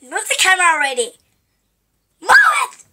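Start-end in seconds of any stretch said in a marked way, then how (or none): 2.2-2.8 very high, loud squawked order; impatient yell. Acted. Snaps after asking to move camera.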